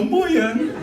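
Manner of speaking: put-on very high dopey child voice; high, hammed-up hello; goofy kid's greeting. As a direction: pipe it up like their silly kids. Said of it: "they'll have kids who go".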